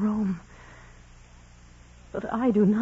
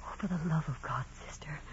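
fast, pleading whisper; desperate whisper; 3 semitones lower, 8 dB quieter, faster than usual. Acted. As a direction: beg under your breath.

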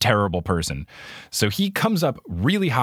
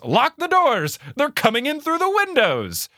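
high-pitched retold yell, not very loud; hammed-up high holler; mock scream. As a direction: imitate an overexcited kid screaming, pitched way up. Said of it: "Conway out loud screams".

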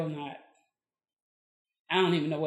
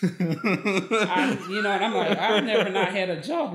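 laughing; 4 semitones higher, 7 dB louder than usual. Real, not acted. Laughs while retelling doctor exchange.

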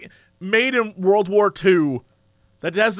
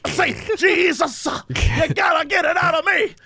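high, hollering revival preacher; fiery preacher; 6 semitones higher than usual. Retold or imitated. Mocking a revival preacher for a friend, high hollering. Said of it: "he's like".

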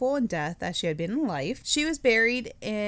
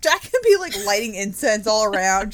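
ordinary talk

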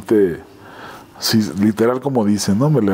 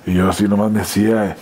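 ordinary talk

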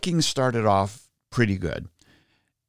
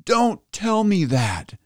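much higher and slow, pained mock plea; anguished protest; 9 semitones higher, slower than usual. Fan pleading with his wife not to spoil the game, much higher and slow. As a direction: plead not to hear the score. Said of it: "And I'm like".

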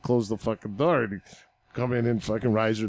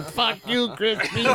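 player snapping back; 9 semitones higher, slower than usual. Retold or imitated. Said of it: "And Verdi would turn".

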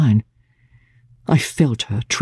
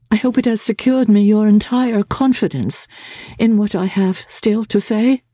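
put-on higher, gentle young woman's voice; Laura's voice; 9 semitones higher than usual. A narrator's reading. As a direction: voice Laura, trembling with resolve.

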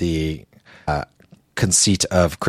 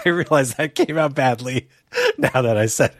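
laughing; 8 semitones higher than usual. Real, not acted. Laughs at his own awkward remark.

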